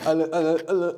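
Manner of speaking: imitated stammer on repeated syllables; mocking stutter. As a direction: tease by getting stuck on the first words. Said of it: "everyone just makes fun of it".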